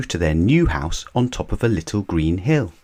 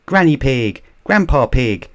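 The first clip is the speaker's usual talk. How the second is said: high, loud happy greeting; glad cry; 7 semitones higher, 4 dB louder than usual. A narrator's reading. Peppa greeting her grandparents, high loud glad call. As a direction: call out to them joyfully. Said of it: "cried Peppa".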